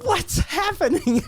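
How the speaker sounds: high, slow mock panic; freaking out. Girlfriend panicking after the shooting, in a high mock-panicked voice. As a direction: play the girlfriend's alarmed cry. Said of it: "she's freaking out".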